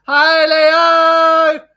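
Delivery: loud, high, hammed-up Brummie shout; crap Birmingham accent. Shown as a demonstration. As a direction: call out in a badly faked Birmingham accent. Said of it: "a crap Birmingham accent on".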